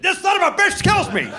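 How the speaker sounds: high-pitched hammed-up exclamation, voice leaping up; hammed-up raving. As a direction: crow it in mock delight, way up high. Acted backstage reaction introducing him onstage.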